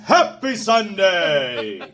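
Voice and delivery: slow, big booming radio announcer. Shown as a demonstration. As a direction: stretch it out like a DJ. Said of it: "He's got a radio voice".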